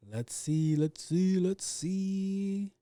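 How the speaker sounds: building sing-song stalling; rising singsong search. Acted. Searching, rising each time.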